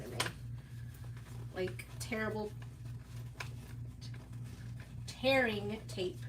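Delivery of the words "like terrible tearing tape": terrible tearing tape spelled out slowly; drawing out "terrible" and "tearing tape"; slow pronunciation demo. Shown as a demonstration. Showing viewers that "terrible" sounds like tearable, slowly. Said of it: "It does sound the same".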